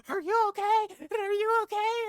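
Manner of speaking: high, loud anxious asking; worried strangers. Retold or imitated. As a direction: mimic the worried drivers at the window. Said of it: "they're just like".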